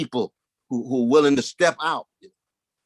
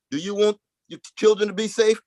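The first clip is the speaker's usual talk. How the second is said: ordinary talk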